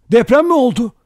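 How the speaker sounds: high, loud alarmed question; crying out. A narrator's reading. Vera feels the ground shake, crying out. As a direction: exclaim with voice shooting up. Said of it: "diye haykırdı".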